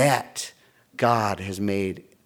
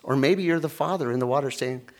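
ordinary delivery

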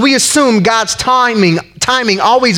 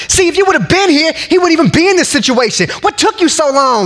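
high, pointed complaint to Jesus; mock accusation, higher and pushy; accusing, impatient; 8 semitones higher than usual. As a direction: throw the blame at him. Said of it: "She ain't rolling up on Jesus like".

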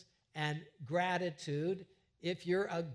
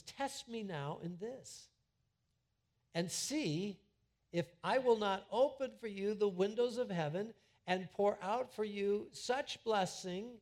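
scripture quoted; 4 semitones higher than usual. Acted. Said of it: "because he says".

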